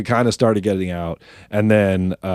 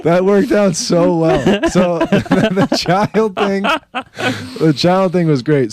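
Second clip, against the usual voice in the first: higher, slow words through laughter; laughing; 11 semitones higher, much slower than usual. Real, not acted. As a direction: savor the memory, laughing. Laughing about his prank.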